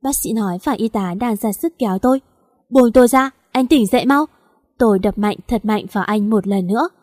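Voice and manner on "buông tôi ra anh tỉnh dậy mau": frantic shout. Acted. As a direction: fight free and scream at him to wake. Nurses pulling her away.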